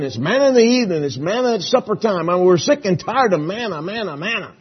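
high, loud, nagging whine; whiny murmuring Israelites. Acted. Israelites in the wilderness nagging Moses, high loud whining. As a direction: whine at Moses like spoiled complainers.

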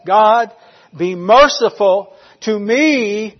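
loud, drawn-out cry for mercy; chest-pounding plea. Retold or imitated. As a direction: plead forcefully, stretching each phrase. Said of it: "as he pounded his chest".

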